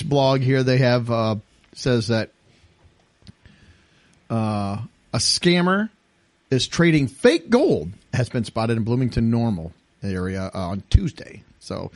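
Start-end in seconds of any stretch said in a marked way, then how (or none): none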